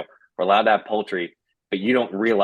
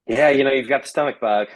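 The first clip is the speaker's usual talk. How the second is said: dismissive nurse; 6 semitones higher, 3 dB louder than usual. Retold or imitated.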